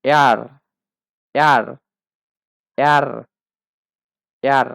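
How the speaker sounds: "এয়ার" said loudly as German air; German letter R. Shown as a demonstration. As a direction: repeat "এয়ার" as a separate, loud model. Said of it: "আর এর প্রনাউন্সিয়েশনটা হবে".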